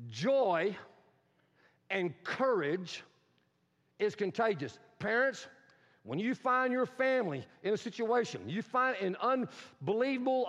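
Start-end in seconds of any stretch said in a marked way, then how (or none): 0.1-4.8 slow, word groups set apart by long pauses; deliberate, spaced-out repeat for note-takers; repeated for emphasis. Shown as a demonstration. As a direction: pause after "Joy" and after "courage", drawing it out. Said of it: "I wanna repeat that".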